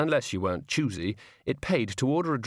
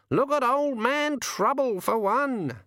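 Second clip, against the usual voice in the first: much higher, slow teasing raven; 14 semitones higher, slower than usual. Acted. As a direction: needle him like a cheeky bird. The raven speaks.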